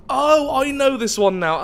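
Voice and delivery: high, loud burst of realisation; sudden recognition. Real, not acted. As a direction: exclaim it as it suddenly clicks.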